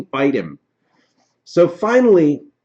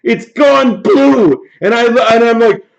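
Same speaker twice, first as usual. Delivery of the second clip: ordinary talk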